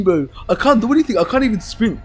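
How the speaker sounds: ordinary talk, nothing marked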